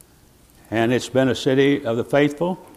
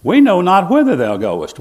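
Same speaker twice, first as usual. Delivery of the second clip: voicing Thomas; 6 semitones higher, 5 dB louder than usual. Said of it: "doubting Thomas".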